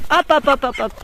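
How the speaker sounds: sharp high calls to the hens; calling hens. Real, not acted. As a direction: hop it out to round up the birds. Appelle les poules. (appel vif aux poules)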